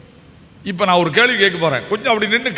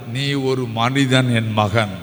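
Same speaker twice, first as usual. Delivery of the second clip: slow, lowered, gentle voice; tender Jesus; 7 semitones lower, much slower than usual. Acted. Preacher voicing Jesus to the outcast, slow and tender. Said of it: "என் ஆண்டவருக்கு".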